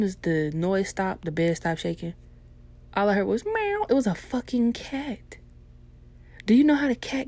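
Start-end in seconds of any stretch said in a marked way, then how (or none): 3.4-3.9 high squeaky meow; cat meow. Retold or imitated. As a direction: mew like a little cat. Cat sound imitated.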